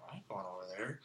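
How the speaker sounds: low, very soft retold refusal; acted-out reluctance under the breath; reluctant aside. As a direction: mumble it low, as if to yourself. Retold reluctant aside to her invitation.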